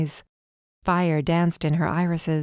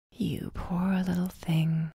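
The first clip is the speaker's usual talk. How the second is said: eerie whisper; 7 dB quieter, slower than usual. A narrator's reading. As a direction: whisper it like a hooded threat.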